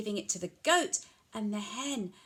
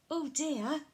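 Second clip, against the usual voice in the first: ordinary delivery, nothing marked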